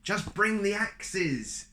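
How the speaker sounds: high, slow loud call; urgent cry. Acted. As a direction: yell down for the axes. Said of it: "Jack cried".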